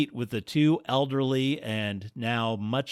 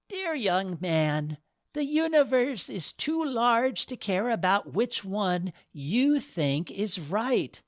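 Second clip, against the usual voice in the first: wise goddess voice; 9 semitones higher than usual. Acted. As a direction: gently scold like a goddess.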